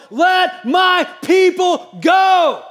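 loud, high, drawn-out shout; booming yell. Acted. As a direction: bellow it as a command, word by word. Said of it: "He yelled to Pharaoh".

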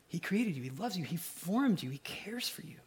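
soft whispered reassurance; a tender unvoiced whisper. Real, not acted. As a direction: breathe it gently to the group. Tender reassurance to youth group.

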